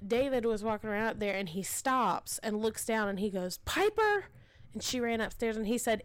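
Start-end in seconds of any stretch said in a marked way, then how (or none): 3.6-4.3 high call up the stairs. Retold or imitated. Father in the attic calling his daughter, high call. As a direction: summon her from the attic.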